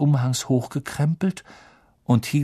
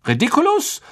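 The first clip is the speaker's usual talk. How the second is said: higher, ringing incantation; spell called out; 5 dB louder than usual. Acted. Teacher shows the class how to cast the spell, ringing call.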